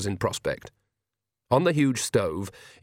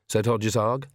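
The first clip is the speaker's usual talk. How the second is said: usual speaking voice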